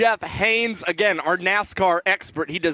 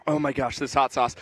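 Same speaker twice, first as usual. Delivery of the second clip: lower, softer, wincing from the burn; pained aside; 7 semitones lower, 4 dB quieter than usual. Real, not acted. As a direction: wince through the heat. Spicy pain mid-announcement.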